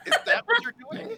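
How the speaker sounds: high, loud, fast incredulous question; loud disbelief. Acted. Blue woman questions the screaming stranger, loud disbelief. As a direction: fire it back high and fast. Game master's character reacts to scream.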